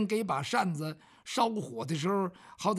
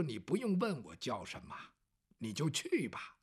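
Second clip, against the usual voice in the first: put-on lower, softer dignified young lord; calm noble scholar; 7 semitones lower, 7 dB quieter than usual. Bao Gong telling Ma Dengcheng to just go, low and calm. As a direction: wave off the question, quiet and composed.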